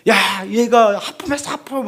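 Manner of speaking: put-on new parent calling out the baby's yawn, thrilled. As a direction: tell everyone the baby yawned.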